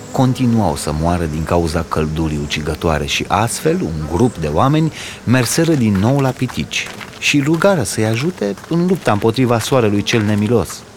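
usual speaking voice

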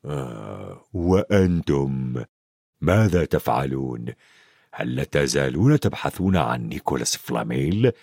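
deep, wary big man's voice; suspicious. A narrator's reading. Hagrid questions the children about Flamel, deep and suspicious. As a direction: probe them warily in a very low voice. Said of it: "ثم نظر إليهم بشك وقال".

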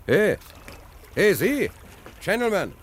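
high hailing call across the harbour. Acted. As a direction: shout up to get his attention. Calling out to a distant policeman.

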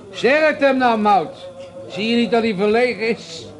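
shouting to deaf wife. Acted. Man shouting at his deaf wife to speak up. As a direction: bellow it across to her.

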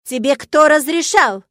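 irate dean demanding answers; furious demand. A narrator's reading. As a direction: hammer out the question angrily. Furious dean scolding students.

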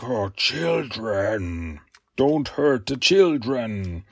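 slow, low and soft, lightly voiced possessed monster; pleading Leatherhead. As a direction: drag out the plea like a dazed beast. Monster voicing Leatherhead's plea.

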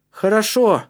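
high, loud approval; encouraging. A narrator's reading. Калиб supports his friend's attempt, encouraging. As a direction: back him up firmly. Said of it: "поддержал его Калиб".

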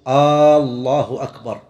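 slow "الله أكبر" with a long questioning "aa" at the start; wrong stretched opening vowel. Shown as a demonstration. Teacher imitating muezzins' mistake, opening "الله" with a long aa. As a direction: drag the first vowel of "الله أكبر" long, "aaallahu akbar". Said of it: "يقلب الجملة الخبرية إلى استفهامية".

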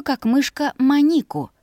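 ordinary delivery

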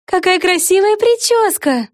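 high, slow admiring praise; cartoon princess. A narrator's reading. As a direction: gush over the hairdo. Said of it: "похвалила Дарси". (мультяшная принцесса)

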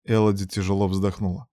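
usual speaking voice

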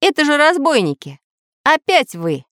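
high, startled exclamation; startled outcry. A narrator's reading. Samodelkin finds the robbers aboard, high startled cry. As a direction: cry out on spotting intruders.

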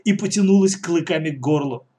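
ordinary delivery

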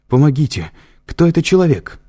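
high panicked shout for help; crying for help. A narrator's reading. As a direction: scream for help in terror. Триш зовёт на помощь. (отчаянный крик о помощи)

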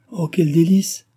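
high, loud burst of delight; a lightly voiced girl exclaiming with joy; joyful cry. A narrator's reading. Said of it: "s'écria celle-ci".

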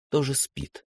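ordinary delivery, nothing marked

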